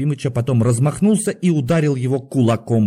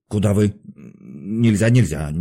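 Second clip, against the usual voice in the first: block him with a brusque refusal; guard refusing; slower than usual. A narrator's reading. Guard stops the patient at the door, brusque.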